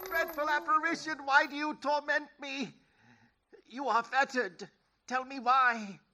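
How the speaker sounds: high, pleading terror; terrified. Acted. Scrooge pleads with the ghost, high and terrified. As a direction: beg for mercy in a high, frightened voice.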